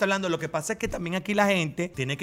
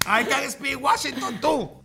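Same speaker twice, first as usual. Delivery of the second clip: higher, slow proud boast; mock triumph; 8 semitones higher, 3 dB louder, slower than usual. Guest jokingly boasting after the tongue twister, high. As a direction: crow about it jokingly.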